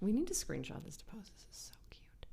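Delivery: soft secret whisper; whispered aside. Real, not acted. Cohost whispering about screenshotting the quiz. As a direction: hush it to your friend.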